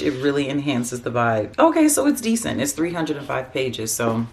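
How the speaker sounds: ordinary talk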